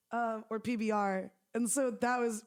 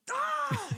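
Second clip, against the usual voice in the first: sharp high yelp of pain. Retold or imitated. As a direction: yelp like you just stubbed your toe. Stubbing toe at night.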